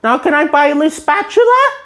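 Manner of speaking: high eager cartoon voice, a touch pleading; SpongeBob voice. SpongeBob pleading with the shopkeeper, high and eager.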